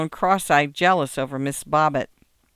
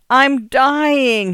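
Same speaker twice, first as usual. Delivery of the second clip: high, loud dying lament; loud, theatrical bellyache misery; melodramatic wail; 9 semitones higher, 7 dB louder than usual. A narrator's reading. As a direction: wail it out like a boy sure he's dying. Boy melodramatically wailing on bed.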